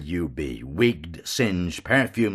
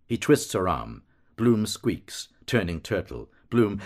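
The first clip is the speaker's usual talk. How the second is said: ordinary delivery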